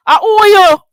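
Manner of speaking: loud, high mocking shout; high mock shout of who are you; mocking yell. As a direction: yell it back in her face. Mocking retort repeated louder.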